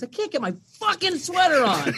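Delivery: put-on high little-girl voice, annoyed; daughter cursing. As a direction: squeak it out like a frustrated small child. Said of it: "she goes".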